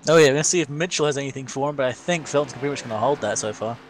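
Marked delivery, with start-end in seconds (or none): none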